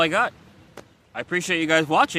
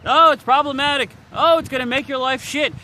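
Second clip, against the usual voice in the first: higher, louder sneering imitation; mocking lecture; 7 semitones higher, 5 dB louder than usual. Mimicking people who told him it's problematic, mocking. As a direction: mimic the nagging critics. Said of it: "from being told left and right".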